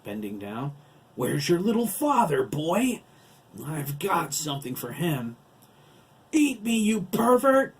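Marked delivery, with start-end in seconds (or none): 1.1-5.4 high, loud, leering taunt; menacing giant. Acted. 6.3-7.7 very high, loud furious retort; spat. Acted. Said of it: "Craig spat".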